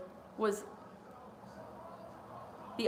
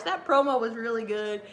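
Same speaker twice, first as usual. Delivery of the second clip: ordinary talk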